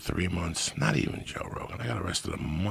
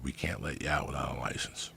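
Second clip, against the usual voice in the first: hushed retelling; 5 semitones lower, 7 dB quieter than usual. Retold or imitated. Retelling what jail staff told him.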